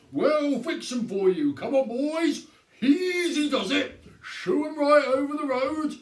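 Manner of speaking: hearty orders to his helpers; sergeant taking charge. A narrator's reading. Sergeant directing the boys.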